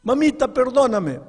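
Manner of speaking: high, loud tearful begging; a boy pleading through tears, acted out; acted crying plea. Son begs his mother to forgive him, acted tearful plea. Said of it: "Él comenzó a llorar".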